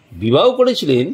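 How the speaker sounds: ordinary talk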